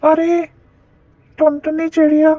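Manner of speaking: loud, high call to someone indoors. Acted. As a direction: shout for the bird to come outside. Kite calls the bird out.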